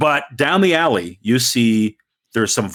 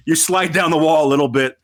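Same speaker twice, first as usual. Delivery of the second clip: ordinary talk, nothing marked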